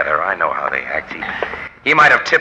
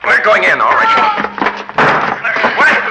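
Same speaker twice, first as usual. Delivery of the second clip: high, loud barked orders; commanding shout; 4 dB louder than usual. Acted. Reporter shouting at the attacker to let her go.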